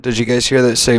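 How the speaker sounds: ordinary delivery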